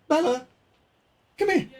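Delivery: high sing-song call to a dog. Real, not acted. Streamer calls his dog Bella up high.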